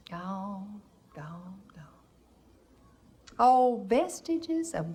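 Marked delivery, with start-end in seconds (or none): 0.1-1.8 soft, dying away to almost nothing; fading lament. Acted. Mother lamenting the lost South, fading softly. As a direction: let each gone fade out.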